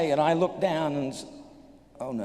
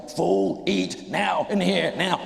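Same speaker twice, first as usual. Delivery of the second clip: demanding stomach voice; 4 semitones higher, 4 dB louder, slower than usual. Retold or imitated. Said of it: "stomach's going".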